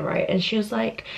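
ordinary talk

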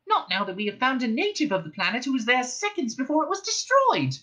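high, brisk mouse voice; matter-of-fact little mouse; Frankie mouse voice. A narrator's reading. Frankie explains.

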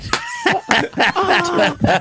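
high squealing laugh. Real, not acted. Laughing at own story.

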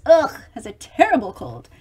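usual speaking voice

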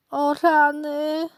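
high, drawn-out piglet whine; acted whimpering dismay; tearful wail. A narrator's reading. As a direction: wail it loud and slow like a crying piglet. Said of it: "zapłakało prosiątko".

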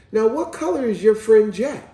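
mother's question. Retold or imitated. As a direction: imitate a mom quizzing her son. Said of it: "So she said".

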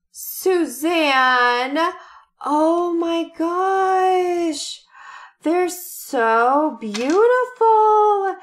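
gasp, then a high, slow, loud rapture; overwhelmed by a beautiful surprise; gasping with joy at the earrings; excited squeal. Real, not acted. Opening a surprise gift.

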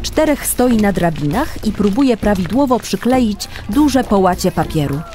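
ordinary talk, nothing marked

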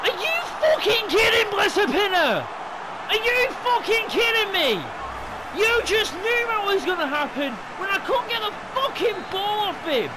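high, loud enraged yelling; furious shout. Real, not acted. Gamer raging at his player, furious shouting. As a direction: scream at the screen in fury.

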